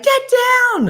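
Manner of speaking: high, loud reenacted yell; retold yell. Owner yells at her attacking dog, retold high yell. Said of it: "And I'm yelling at her".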